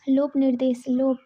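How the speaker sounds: ordinary talk, nothing marked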